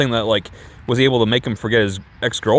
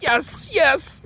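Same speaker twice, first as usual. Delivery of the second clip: very high, gleeful cheer at moderate volume; mock cheering. Viewer reacting to girl knocking him out, high cheering. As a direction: celebrate in a high voice.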